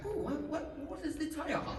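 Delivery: high, soft American curiosity; mock American fascination, light and high; imitated American woman. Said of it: "American, right?".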